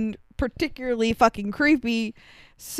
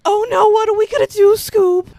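much higher and louder, fast panicky cartoon whine; Shaggy Rogers impression; 11 semitones higher, 8 dB louder, faster than usual. Imitating the farmer as a Southern Shaggy, panicky. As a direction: yelp it out like a scared cartoon slacker. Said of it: "old man Southern version of Shaggy Rogers from Scooby-Doo".

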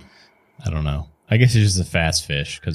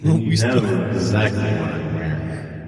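character voice; 3 semitones higher than usual. Acted. GM voices a strange NPC.

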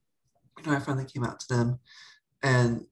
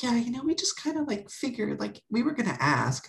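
high-pitched tentative quote; imitated parents; 12 semitones higher than usual. Parents admitting they had figured, imitated high voice.

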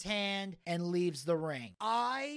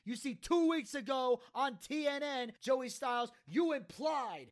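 accusing wrestler, pitched up, retold; passionate promo; 4 semitones higher than usual. Taz accuses Joey of punking him out, passionate promo. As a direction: build the accusation as a mock promo. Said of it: "Taz coming out with the passionate promo".

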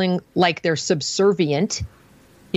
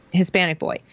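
ordinary delivery

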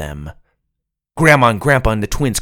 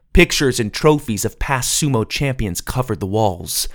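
ordinary delivery, nothing marked

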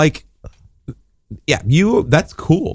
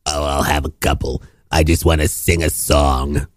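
smoker mom; 9 semitones lower than usual. Pretend. Ma offered more cigarettes, low smoker voice. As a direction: play a deep-voiced chain-smoking ma. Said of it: "Would you like some more cigarettes, Ma?".

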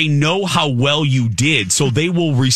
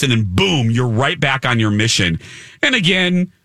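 ordinary talk, nothing marked

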